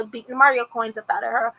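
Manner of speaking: usual speaking voice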